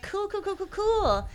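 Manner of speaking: high, tight mock-cheerful cool; sarcastic cool. Stuck with a huge repair bill, fake-cheerful sarcasm. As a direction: bite down on forced cheer.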